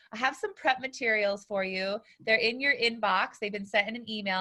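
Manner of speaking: ordinary talk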